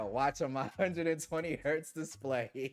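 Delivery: slow, laugh-broken read of the chat; can't get through it without laughing; slowed down, laughing at the comment; laughing while reading. Real, not acted. Reading funny super chat aloud.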